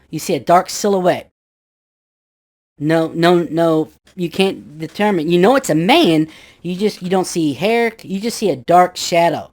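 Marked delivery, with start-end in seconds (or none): none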